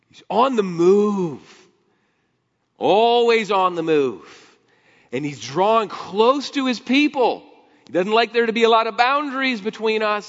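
high, loud, driving sermon voice; preaching at full force. Acted. Preacher exhorting his congregation, loud and high. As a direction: preach it out with rising fervor.

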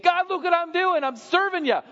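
high, loud, desperate pleading; frantic illegitimate son crying for approval; frantic striver. Acted. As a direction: beg for God's notice, frantic. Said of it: "running around frantic saying".

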